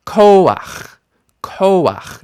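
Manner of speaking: "koach" with a high, strong KO, falling; KO-akh, let the ending drop away; stress on KO. Shown as a demonstration. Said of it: "And here we get".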